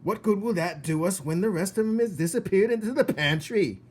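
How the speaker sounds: angry cook. Acted. Cook raging at Mig, loud and furious. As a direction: rage at her.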